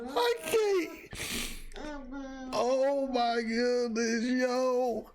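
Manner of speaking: very high, weepy laughing voice; real laughter tipping into crying; laughing to tears. Real, not acted. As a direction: squeal words between helpless laughs. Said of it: "I got tears in my eyes".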